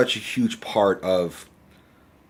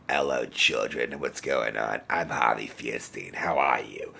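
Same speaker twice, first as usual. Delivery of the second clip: Harvey Fierstein impression; 10 semitones lower, 6 dB quieter than usual. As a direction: drop way down and greet the kids like a gravel-voiced star. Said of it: "starting to sound like Harvey Fierstein".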